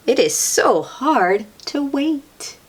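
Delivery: ordinary delivery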